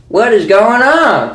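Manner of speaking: a hint of a rural old man; country accent. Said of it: "country accents".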